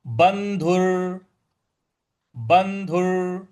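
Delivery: बंधुर said very slowly, syllable by syllable; slow recitation. Shown as a demonstration. Teacher modelling the word slowly for students. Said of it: "इसके बाद".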